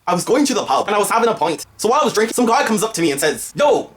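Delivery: amateur comic Irish brogue; bad Irish accent. Shown as a demonstration. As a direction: put on a hammy, shaky-at-best Irish accent. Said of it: "I have a really bad Irish accent".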